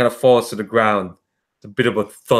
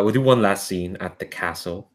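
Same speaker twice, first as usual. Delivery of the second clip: ordinary talk, nothing marked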